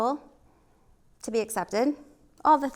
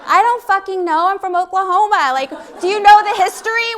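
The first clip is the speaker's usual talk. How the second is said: loud, higher, exasperated retort; exasperated outburst; 9 semitones higher, 11 dB louder than usual. Retold or imitated. Fed-up answer to Chicago strangers, loud and exasperated. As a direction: blurt it out, fed up with the question. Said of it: "I'm like".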